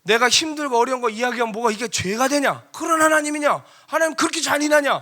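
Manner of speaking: high, shouted protest; acted-out indignant outburst; aggrieved complaint. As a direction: pour out the grievance at full volume. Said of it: "막 하소연했습니다".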